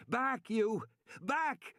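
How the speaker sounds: high commanding yell; shout. A narrator's reading. Man edging toward the trees, shouting at the dinosaur. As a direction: drive it back with your voice. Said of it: "Regis shouted".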